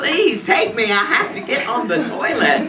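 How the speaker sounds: high, loud plea through laughter; desperate plea. Retold or imitated. Woman in labour begging for the toilet, laughing mock plea.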